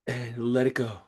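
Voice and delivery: hushed cue fading to almost nothing; fading release. Shown as a demonstration. Voice fades into the breath hold.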